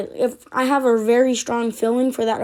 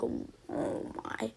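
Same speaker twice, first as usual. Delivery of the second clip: acted whisper of dread, very soft; whispered dismay; 18 dB quieter than usual. Fighter facing the highest-ranked opponent, whispered mock dismay. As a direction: breathe it out under your breath.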